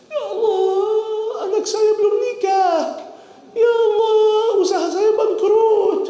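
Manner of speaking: high, wailing, half-sung lament; mock crying prayer. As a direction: ham up a tearful worldly plea. Mocks worldly crying prayers.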